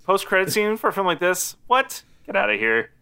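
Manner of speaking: what, a post-credit scene?; incredulous. Real, not acted.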